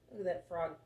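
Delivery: ordinary delivery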